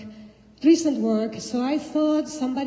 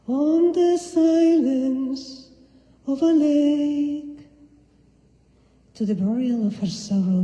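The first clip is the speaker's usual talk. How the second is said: slow, half-sung poem recital, louder; poetry reading; 6 dB louder, slower than usual. Acted. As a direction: stretch the words almost into song. Reads Long-Term Memory poem.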